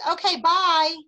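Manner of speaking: hammed-up shop clerk's goodbye; mock goodbye. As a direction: call out an exaggerated farewell. Imagined shop clerk's breezy goodbye.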